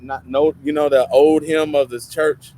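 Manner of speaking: ordinary delivery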